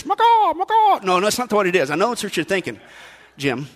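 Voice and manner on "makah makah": MAKAH as a high mock call; agent signal noise. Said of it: "I'm gonna make that noise".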